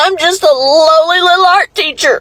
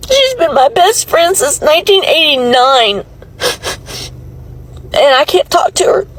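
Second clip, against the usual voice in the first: ugly crying. Real, not acted. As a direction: cry it out in anguish. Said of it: "I'm ugly crying on it".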